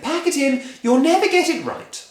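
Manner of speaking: high, loud scolding wife; Missus Cranky nagging. Acted. Missus Cranky scolding husband, high nagging voice. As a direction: nag him, sure he'll fail.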